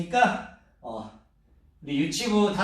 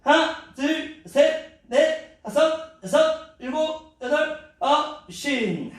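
doing duck kicks; 9 semitones higher, much slower than usual. Real, not acted. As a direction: count while working hard. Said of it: "힘듭니다, 저도".

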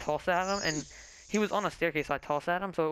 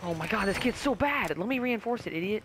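frustrated yelling; 6 semitones higher, 4 dB louder than usual. Real, not acted. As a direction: vent at a useless teammate. Frustrated at bad teammate.

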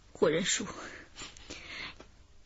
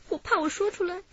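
fearful plea; 7 semitones higher, 7 dB quieter than usual. Acted. Suspect confesses her fear.

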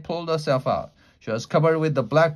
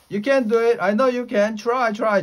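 high, insistent cheering-on; urgent encouragement; 9 semitones higher, 4 dB louder than usual. A narrator's reading. Jack urges Annie out of the mud, insistent. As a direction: push them to keep trying, pitched up. Urging stuck girl to pull free.

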